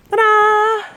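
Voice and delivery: high, loud grand reveal; excited ta-da. Real, not acted. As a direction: present it like a magic trick. Showing off the pony's beard.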